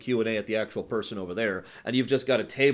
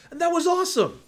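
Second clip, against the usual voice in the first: much higher and louder, thrilled; excited outburst; 6 dB louder than usual. Real, not acted. Streamer hyping Ubisoft's live stream to chat, thrilled shout. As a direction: burst out with delight.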